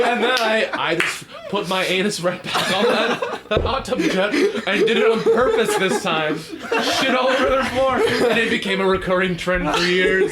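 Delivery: cracking up at the joke; storyteller joking an absurd ending, laughing. Real, not acted.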